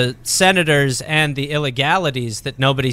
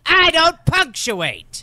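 much higher, very slow villain proclamation; Batman villain; 11 semitones higher, much slower than usual. Pretend. Comedian mocking the long-sentence writer, as a hammed-up villain. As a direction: declare it like a cartoon supervillain reveal. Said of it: "like a Batman villain".

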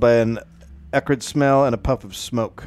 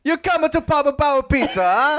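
put-on Italian accent; 13 semitones higher than usual. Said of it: "went to Italian".

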